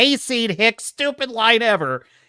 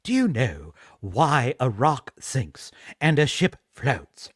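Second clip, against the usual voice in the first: low, mock-posh English actor; ponderous posh English, pitched down; posh English accent; 7 semitones lower, 5 dB quieter, slower than usual. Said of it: "They take a posh English accent".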